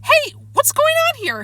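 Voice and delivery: very high, alarmed cry; high-pitched bewildered yell. A narrator's reading. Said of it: "Harrison cried".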